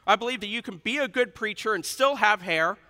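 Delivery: normal talking voice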